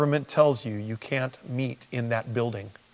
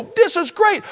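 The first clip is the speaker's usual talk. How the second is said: much higher, louder cheerful exclaim; mock delight; 7 dB louder than usual. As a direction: squeal it like a happy churchgoer. Imagined listener's delight.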